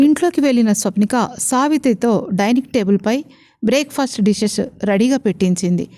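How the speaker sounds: plain reading voice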